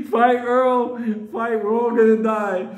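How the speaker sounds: scared pals urging Earl to fight; panicked urging. Retold or imitated.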